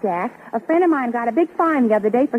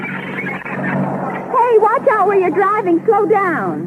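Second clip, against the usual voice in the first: alarmed; 8 semitones higher, much slower than usual. Acted. Wife scolds reckless driver.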